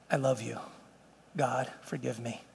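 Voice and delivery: very soft, low acted prayer; hushed plea. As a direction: ask forgiveness almost under your breath. Acts out asking God's forgiveness.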